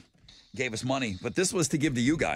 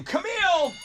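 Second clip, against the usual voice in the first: excited call; 4 dB louder, slower than usual. Real, not acted. Calling out the winner.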